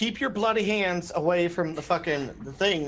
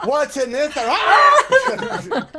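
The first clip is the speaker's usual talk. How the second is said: high laughing shriek of shock; startled shout; 12 semitones higher, 9 dB louder, much slower than usual. Real, not acted. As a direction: yelp in startled surprise. Shocked by file contents.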